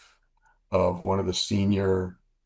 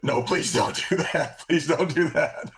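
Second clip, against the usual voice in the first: slow, higher pleading through laughter; laughing mock plea; 9 semitones higher than usual. Pilot begs retired general not to call, laughing plea. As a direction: beg him not to, half laughing.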